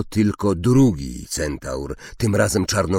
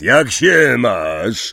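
loud, drawn-out hearty greeting; big slow hello; relieved; 5 semitones higher, 7 dB louder, much slower than usual. A narrator's reading. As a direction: greet an old friend with relief. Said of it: "powiedział z ulgą Hagrid".

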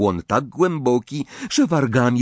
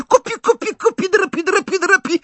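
very high, loud gleeful chant; gleeful shriek; 4 dB louder, faster than usual. A narrator's reading. Poltergeist egging on the fighting elves, shrieking. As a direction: shriek with delight to stir the fight. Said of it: "wrzasnął uradowany Irytek".

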